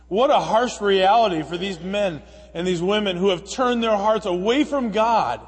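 ordinary talk, nothing marked

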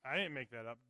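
normal talking voice

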